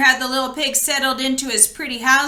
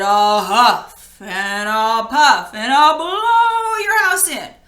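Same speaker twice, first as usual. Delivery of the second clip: big bad wolf; 5 dB louder, slower than usual. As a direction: stretch out huff and puff, then blow. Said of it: "he said".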